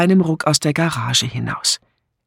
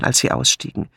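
usual speaking voice

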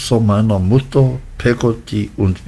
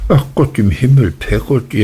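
mild exclamation, quick and higher at first; exclaiming; 3 semitones higher, faster than usual. A narrator's reading. Mother to her foolish servant, mildly exclaiming. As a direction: cry out at her, lightly exasperated. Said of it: "rief meine Mutter".

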